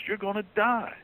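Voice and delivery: sudden high-pitched warning cry; voicing Paul's stern warning. Preacher voicing Paul warning the sinning Corinthians, high alarmed warning. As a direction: raise the pitch sharply to warn of death.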